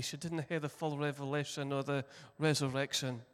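normal talking voice

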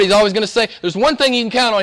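ordinary talk, nothing marked

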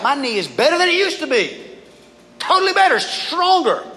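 high-pitched mock enthusiasm; excited boast. Rehabbed patient bragging about the knee, excited boast. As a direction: gush proudly, pitch leaping up.

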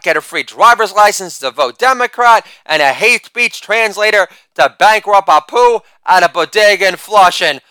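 normal talking voice

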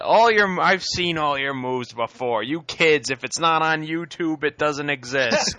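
loud, high-pitched scolding villain; Taskmaster. Acted. Villain berating kids about their fighting moves, loud scolding. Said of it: "he's like".

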